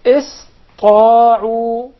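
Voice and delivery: long, loud recited ista'u; recited demo word. Shown as a demonstration. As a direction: model ista'u for repetition. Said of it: "The word".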